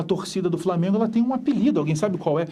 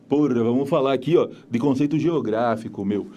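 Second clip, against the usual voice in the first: São Paulo accent; 5 semitones lower than usual. Shown as a demonstration. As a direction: lower paulistano accent with "meu" tag. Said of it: "com português de São Paulo".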